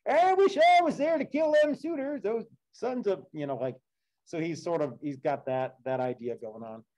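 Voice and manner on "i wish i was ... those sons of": much higher, hammed-up eager old man; mock Laertes. Teacher paraphrasing Laertes for the class, high hammed-up voice. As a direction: play the old father itching for a fight. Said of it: "So Laertes is like".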